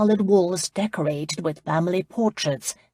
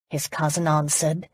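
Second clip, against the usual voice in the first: plain reading voice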